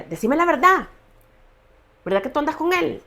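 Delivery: high, loud demanding yell, retold; imitating furious husband. Husband striking her as he demands the truth, in a retold furious yell. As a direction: bark the accusation like the angry husband.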